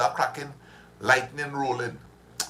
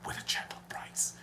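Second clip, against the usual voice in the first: ad slogan whispered; whispered ad line; 13 dB quieter than usual. Retold or imitated. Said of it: "The advertisement used to go so".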